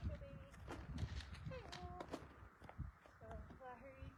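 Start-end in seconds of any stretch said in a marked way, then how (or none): 0.1-2.0 pet voice. Real, not acted. Cooing to her horse.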